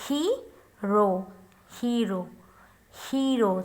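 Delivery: hero with a long ee in the first syllable. Shown as a demonstration. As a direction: stretch the E in hero. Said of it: "long E".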